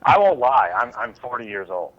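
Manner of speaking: ordinary delivery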